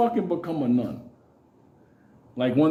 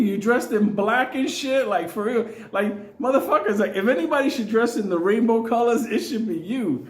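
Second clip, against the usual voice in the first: ordinary delivery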